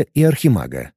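ordinary delivery

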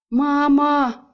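громко и выше, блеющий испуг; проблеять от ужаса; испуганное блеяние. A narrator's reading. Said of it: "в один голос проблеяли". (женихи в ужасе громко блеют «Мама!»)

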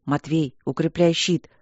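ordinary delivery, nothing marked

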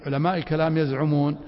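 ordinary talk

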